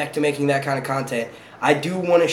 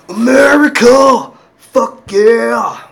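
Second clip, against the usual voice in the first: loud, high, drawn-out whoop; hammed-up triumphant holler; mock patriotic yell; 8 semitones higher, 8 dB louder, much slower than usual. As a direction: belt it out like a rowdy patriot. Patriotic outburst after firecracker.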